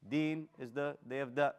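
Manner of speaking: ordinary talk, nothing marked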